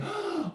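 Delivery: very high gasp of thrilled surprise; delighted gasp. Acted. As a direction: gasp like the best thing just happened. Teacher acting delighted surprise.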